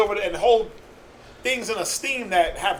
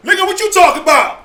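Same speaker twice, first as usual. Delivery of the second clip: mock hostile snap; 9 semitones higher, 8 dB louder, faster than usual. Brother snaps at a friendly hello.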